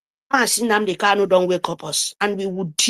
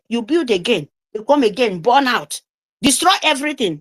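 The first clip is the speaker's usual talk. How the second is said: ordinary delivery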